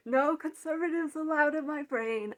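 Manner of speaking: fierce, loud banishment; loud defiant shout. Retold or imitated. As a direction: shout the intruders out of your head. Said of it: "I just say".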